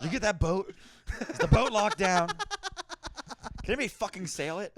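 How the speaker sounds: high, loud, laughing through a hammed-up tirade; laughing mock rant. Founding father ranting about the boat, laughing and loud. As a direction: bark boat questions while cracking up.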